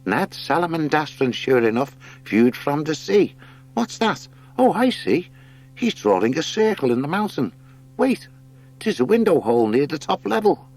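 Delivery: high, eager voice reading a drawing; excited hare. Acted. Hare interprets the heron's sketch, high eager voice.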